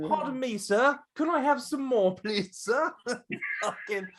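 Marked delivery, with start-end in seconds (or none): 0.1-2.9 high, pleading British urchin; mock British accent. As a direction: beg politely in a caricature British voice. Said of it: "Your British accent is always the same".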